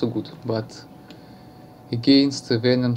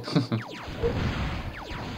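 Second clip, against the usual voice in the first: short embarrassed laugh; breathy little laugh; soft laugh; 6 dB quieter than usual. Real, not acted. Apologises for his accent, laughs.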